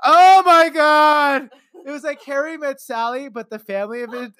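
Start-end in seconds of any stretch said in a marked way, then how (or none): 0.0-1.5 high, loud, slow drawn-out oh my God; ecstatic moan. Retold or imitated. Family moaning over pizza, loud, high and drawn out. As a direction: savor each word like bliss. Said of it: "we're like this".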